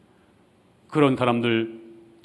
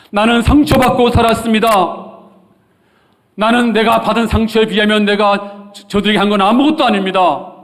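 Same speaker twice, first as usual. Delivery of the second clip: high, loud protest, acted out; indignant excuses; 9 semitones higher, 7 dB louder than usual. Preacher acting Zacchaeus before his change, indignant excuses. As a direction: cry out in self-justification, very loud. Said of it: "이렇게 변명이 많았을 것입니다".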